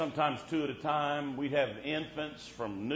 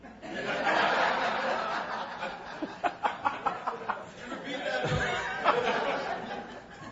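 long airy laughing fit; long breathy laugh; 13 semitones higher than usual. Real, not acted. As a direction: laugh long at your own joke. Long laugh at own joke.

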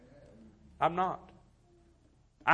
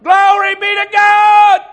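mock glory shout; 20 dB louder than usual. Preacher mimics smug churchgoers, a high, hammed-up shout. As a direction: ham up a rejoicing believer shouting for heaven.

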